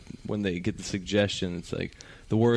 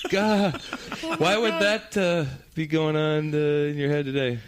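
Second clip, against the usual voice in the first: uneasy host; 5 semitones higher than usual. Retold or imitated. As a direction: stall awkwardly as the host. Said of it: "It's like".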